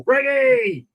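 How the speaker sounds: high, delighted call of a friend's name; bright happy greeting; excited shout-out. Real, not acted. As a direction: sing out the name like spotting a mate.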